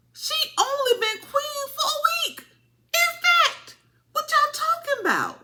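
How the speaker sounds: high, disbelieving mock rant at the crowd; imitating yourself calling out the crowd in disbelief; incredulous. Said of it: "I'm like".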